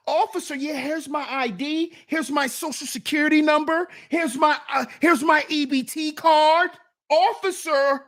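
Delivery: high, rising, eager-to-please voice; mock a man anxiously offering up every document; hammed-up high-pitched compliance; over-eager deference to police.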